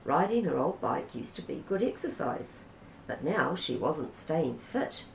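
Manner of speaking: plain reading voice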